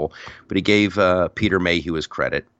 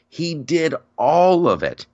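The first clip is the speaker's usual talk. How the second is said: ordinary talk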